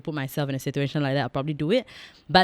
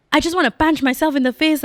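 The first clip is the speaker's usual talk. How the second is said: exasperated; 10 semitones higher, 6 dB louder than usual. Real, not acted. Exasperated outburst.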